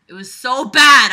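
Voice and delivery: loud, high complaint; loud groan of a line; emphatic. Real, not acted. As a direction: stress how awful it was.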